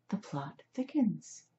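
slow, soft, whispery; hushed intrigue. A narrator's reading. Rescuer intrigued by the talking cat, hushed intrigue. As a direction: savour it under the breath.

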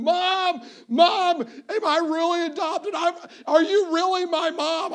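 put-on high, tearful little boy pleading; crying child. Five-year-old asking his mom if he's adopted, high and tearful. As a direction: ask your mom through half-laughing tears. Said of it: "I'm crying".

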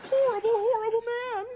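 very high squealed impression of an upset crowd member; mock audience outcry. Comic mimicking offended Boise fans after his joke, mock outrage. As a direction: squeal it high, building to a loud wail.

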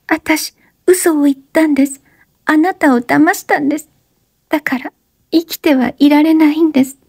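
put-on high young woman's voice, urgent plea; breathless high-pitched plea; Oshino, pleading. A narrator's reading. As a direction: beg him to stop talking. Oshino confessing.

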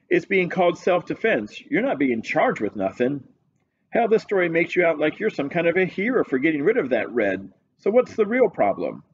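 a lazy, unbothered police sergeant; desk sergeant voice. Acted.

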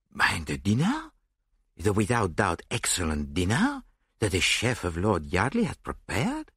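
put-on foreign-accented detective, affronted questioning; affronted gourmet with a foreign accent; Poirot, affronted. A narrator's reading. Detective objecting to missing dinner, affronted accented voice.

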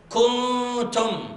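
كنتم with the nun hidden in a light nasal before the ت; light ghunna. Shown as a demonstration. Teacher showing students the correct light ghunna. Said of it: "ولكن غنة مرققة".

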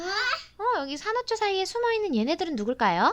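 put-on fast, excited and high for a child. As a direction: gasp and rush the question like a discovery. Mother excitedly asks child.

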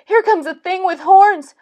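high, fast, loud rabbit voice; panicked small animal; shouting rabbit. A narrator's reading. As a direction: shout a warning as a frightened rabbit. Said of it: "shouted a rabbit".

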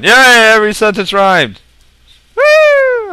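high, loud cheering; mock celebration. Player celebrating the improvised poem, loud mock cheer. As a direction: cheer loudly for your own achievement.

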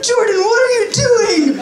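be mom walking in, appalled; mom's voice. Retold or imitated. Mom catches son in the litter box, high shocked voice. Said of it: "My mom's like".

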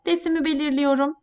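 usual speaking voice

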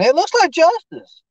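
high-pitched puzzled guess; high excited guess. Real, not acted. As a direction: voice jumps way up, slow.

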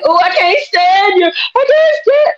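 hammed-up sobbing complaint; mock ugly cry. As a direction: daughter leaving, she wails at the Lord in a playful ugly cry. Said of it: "the ugly cry".

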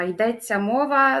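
ordinary delivery

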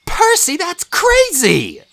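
put-on high, loud, slow girl's outcry; a shocked friend protesting; high, loud disbelieving cry; stunned Annabeth. A narrator's reading. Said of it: "Annabeth's jaw dropped".

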